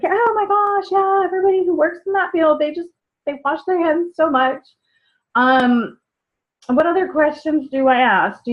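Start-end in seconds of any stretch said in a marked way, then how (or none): 0.0-4.6 higher, bubbly rush of agreement; gushing. Retold or imitated. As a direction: gush at them, light and high. Voicing her gushing reply to customer.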